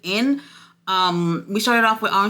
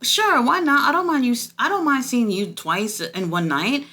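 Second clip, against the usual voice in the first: jumps much higher, caught off guard, then settles; startled surprise; 8 semitones higher than usual. Retold or imitated. Vlogger reacting to stepdaddy showing up, startled and much higher. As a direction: squeak out a surprised okay, then shrug it off. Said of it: "and I was just like".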